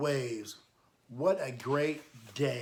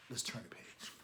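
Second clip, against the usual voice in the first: quiet aside; 6 semitones lower, 13 dB quieter than usual. Real, not acted. Aside while reading aloud.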